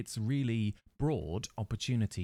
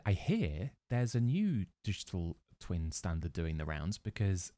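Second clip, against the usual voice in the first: ordinary delivery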